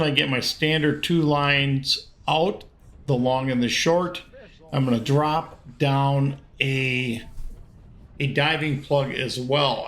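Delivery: low, calm voiceover; voiceover narration. Acted. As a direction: explain it like a fishing show host. Voiceover explaining the catch.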